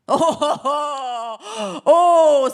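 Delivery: slow, loud booming giant laugh; giant's booming oh. Acted. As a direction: boom it out like a giant. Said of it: "s'exclame le géant".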